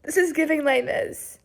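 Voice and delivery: full-voice loud rant about the book; loud reaction. Real, not acted. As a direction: burst out loud after the whispering.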